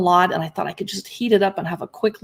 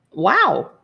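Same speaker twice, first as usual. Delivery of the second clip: ordinary delivery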